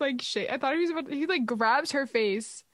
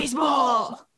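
louder excited shout of Baseball; 3 semitones higher, 6 dB louder, slower than usual. Real, not acted. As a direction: cheer out Baseball like a fan. Excited echo of favorite scene.

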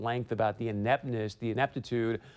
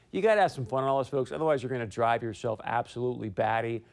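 ordinary delivery

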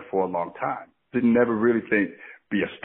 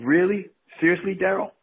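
incredulous; 8 semitones higher than usual. Retold or imitated. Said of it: "And I'm thinking".

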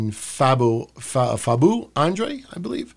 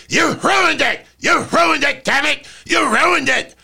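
high, loud mock tantrum yell; angry Vince shout; 11 semitones higher, 3 dB louder than usual. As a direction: scream it like a furious boss. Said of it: "Tony Khan ... going".

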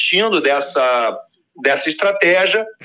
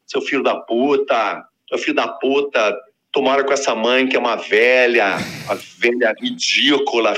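mocking kid insult; 6 semitones lower than usual. Boy insults the neighbor at his door, lightly voiced sneering taunt. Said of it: "falava assim na porta".

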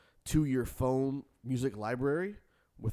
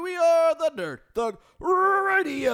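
hype announcer shout; 8 dB louder than usual. Acted. Hosts introduced shouted.

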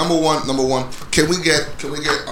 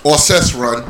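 ordinary talk

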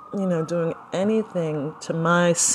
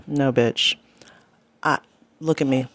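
ordinary talk, nothing marked

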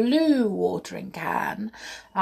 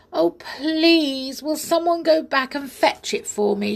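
high, pleading storybook cry; upset cry; 9 semitones higher, 4 dB louder than usual. A narrator's reading. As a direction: beg for help, upset. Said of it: "she cried".

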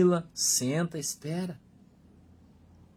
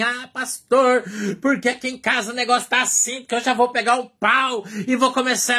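upset church member; 6 semitones higher than usual. Retold or imitated. Quoting an angry congregant caller.

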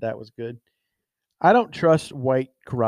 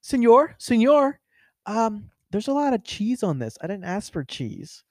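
higher-pitched, fussy complaining customer; 8 semitones higher than usual. Retold or imitated. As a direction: imitate a diner politely flagging the waiter. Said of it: "and they'll be like".

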